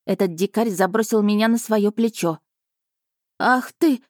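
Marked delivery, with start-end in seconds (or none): 3.4-4.0 higher-pitched indignant outburst; a burst of mild outrage. A narrator's reading. Heroine protests being thrown over the dragon's shoulder, indignant. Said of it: "возмутилась я".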